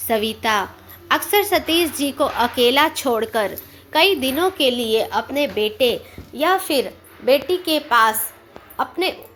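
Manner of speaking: plain reading voice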